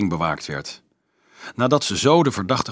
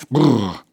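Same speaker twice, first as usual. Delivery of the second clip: shivering brrr; 2 semitones higher, 5 dB louder than usual. A narrator's reading. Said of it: "shivering after icy water".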